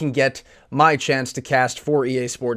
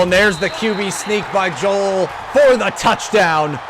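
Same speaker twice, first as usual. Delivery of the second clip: raised, shouted touchdown call; excited touchdown call; 5 semitones higher, 3 dB louder than usual. Acted.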